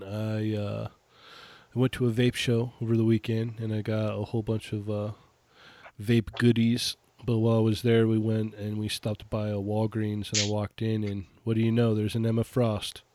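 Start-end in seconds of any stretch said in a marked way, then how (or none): none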